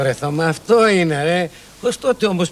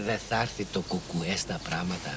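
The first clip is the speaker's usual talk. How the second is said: much lower and softer grumble; muttered aside; 14 dB quieter than usual. Acted. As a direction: mutter it under your breath. (ο Δημήτρης γκρινιάζει στην άκρη, σιγανά και χαμηλά)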